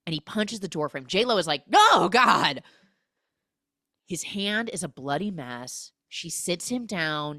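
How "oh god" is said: loud, pitched-up dismay; mock horrified cry. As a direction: cry out in hammed-up horror. Retold woman seeing him punch door.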